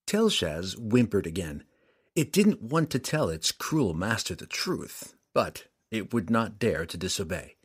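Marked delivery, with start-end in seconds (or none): none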